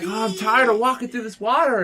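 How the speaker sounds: high-pitched mock crybaby whine; whiny complaining. Imitating a tired friend wading through water, whiny complaining. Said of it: "he starts complaining".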